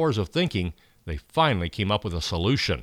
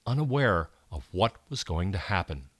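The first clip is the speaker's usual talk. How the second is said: usual speaking voice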